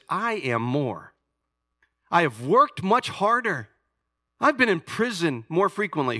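high, loud scripture reading; fiery preacher reading scripture; preaching at full force. Acted. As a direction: proclaim Paul's boasts like a challenge. Reads Paul's boasts with force.

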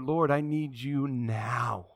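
slow, drawn-out plea; crying out to God. Acted. Believer begs the Lord for help now, drawn-out crying out. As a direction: stretch each word into an aching appeal. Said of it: "you crying out to the Lord".

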